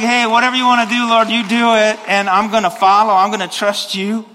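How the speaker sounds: loud, high, fast eager prayer; fervent surrender to God. Shown as a demonstration. Preacher voices his own eager prayer, loud and high. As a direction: throw yourself open to the Lord. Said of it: "So I'm just like".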